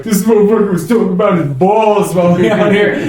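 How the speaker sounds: imitating an indignant audience member; lightly voiced offended guy; outraged stranger. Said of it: "he's like".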